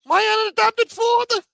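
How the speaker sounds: high shouted put-on voice; mock whiny son. Mocking the man calling Gotti his unadopted father, high and loud. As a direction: wail it like a spoiled kid.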